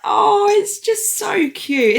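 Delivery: high, slow, gushing coo. Real, not acted. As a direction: melt over the news. News of friend's baby.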